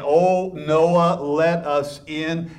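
high, loud desperate plea; begging crowd. Pretend. Doomed people pounding on the ark, loud desperate plea. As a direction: beg like people locked outside.

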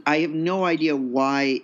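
ordinary talk

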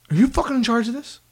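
imitated angry yelling. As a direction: act out an irate coach challenging someone. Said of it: "like, yelling at me".

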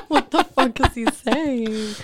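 helpless laughter, words stretched slowly; laughing. Real, not acted. Co-host baffled by the Australian slang, laughing. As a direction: squeeze the question out through laughter.

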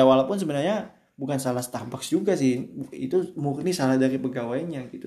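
ordinary delivery

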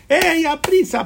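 calling out high and loud to hurry her; ey, hurry, call her in a rush; excited shout toward the tent; urgent call. Acted. Abraham calls to Sara.

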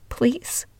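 tearful whisper; whispered plea. Retold or imitated. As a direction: beg in a breathless whisper. Fighting back tears, begging.

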